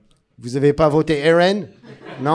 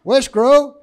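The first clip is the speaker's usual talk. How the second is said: higher banlieue Seine-Saint-Denis slang greeting; Seine-Saint-Denis accent; 9 semitones higher, 3 dB louder than usual. Pretend. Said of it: "avec cet accent". (accent du 93, plus aigu, gouailleur)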